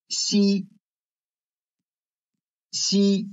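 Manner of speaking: 西 high, loud and level, each time; tone drill. Shown as a demonstration. Teacher reading for students to circle, 西 high and level. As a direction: hold 西 on a high first tone. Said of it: "focus on the tones".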